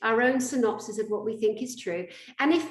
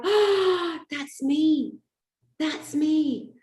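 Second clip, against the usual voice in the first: shocked realisation; 6 semitones higher, slower than usual. Retold or imitated. Shocked self-recognition.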